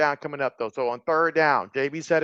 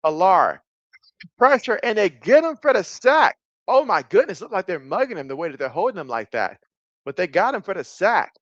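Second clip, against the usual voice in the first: excited, higher-pitched game call; raised, urgent sportscaster delivery; live play-by-play; 4 semitones higher, 3 dB louder than usual. Real, not acted. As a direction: call the sack live as it happens.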